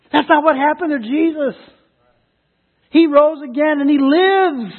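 high, forceful proclamation; emphatic preaching. Real, not acted. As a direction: proclaim it with ringing conviction.

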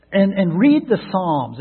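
normal talking voice

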